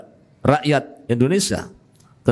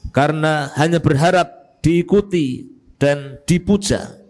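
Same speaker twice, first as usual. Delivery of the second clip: fervent sermon delivery, raised pitch; preaching; 7 semitones higher than usual. Acted. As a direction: preach it with rising conviction. Preaching with rising force.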